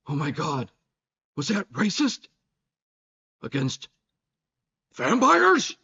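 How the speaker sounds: melodramatic robotic narrator; dramatic AI voice. Retold or imitated. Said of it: "still the same dramatic tone".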